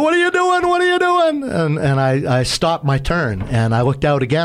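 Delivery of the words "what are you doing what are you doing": very high panicked cry; alarmed high yelp at the helmsman; freaked out. Retold or imitated. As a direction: imitate the panicked captain. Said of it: "He freaked out".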